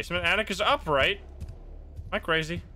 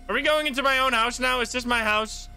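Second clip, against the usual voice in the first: surprised; 8 semitones higher, 4 dB louder than usual. Real, not acted. Startled, recognising his own house.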